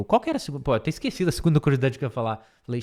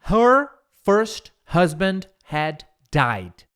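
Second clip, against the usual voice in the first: slow model reading; 3 semitones higher, much slower than usual. Shown as a demonstration. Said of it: "Próxima frase".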